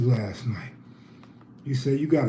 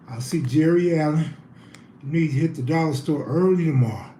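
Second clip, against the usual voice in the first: usual speaking voice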